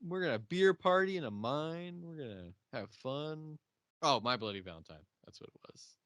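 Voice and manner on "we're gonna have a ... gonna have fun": loud, high cheerful pitch; hammed-up eager miner; mock party bro. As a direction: hype up the mine party. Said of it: "but they're like".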